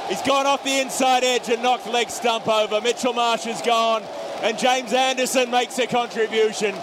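cricket commentator. Real, not acted. Live call of a wicket.

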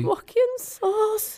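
high, slow startled question. Real, not acted. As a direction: ask in alarm who this is. Startled by a stranger.

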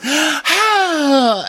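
long high-pitched theatrical wail; imitation of an overacted wail; mock wail. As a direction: cry out into the open air, hammed up. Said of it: "so that you don't just have to wail".

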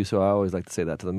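ordinary talk

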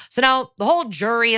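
ordinary delivery

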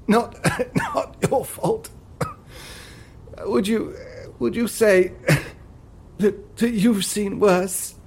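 halting, laughing through it; a character. Acted. A figure asking a friend if he's seen worse, halting. Voiced figure in audio drama.

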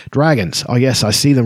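ordinary delivery

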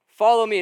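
"Follow" said loud and falling, the phrase set apart; weighty words. Shown as a demonstration. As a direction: punch "Follow me" as a solemn call. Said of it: "those two words are weighty words".

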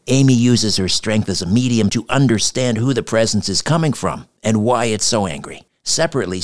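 upbeat ad read; podcast ad read. Acted. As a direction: sell the show, friendly. Reads a podcast ad.

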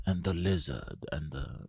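put-on low, soft documentary old man; low old-man commentary voice; old man narrator. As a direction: imitate an old nature narrator, hushed and deep. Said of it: "You know that old man voice".

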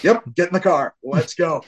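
impatient dad hurrying son; urgent call to the car. Retold or imitated. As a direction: push him into the car, fast.

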